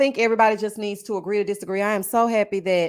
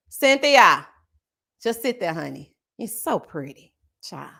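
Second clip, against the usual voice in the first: ordinary talk, nothing marked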